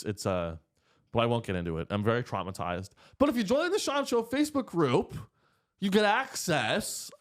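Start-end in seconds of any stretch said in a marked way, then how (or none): 3.2-7.1 broadcaster voice. Shown as a demonstration. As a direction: stretch it out like a radio host. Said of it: "I'm trying to learn to have a broadcaster's voice".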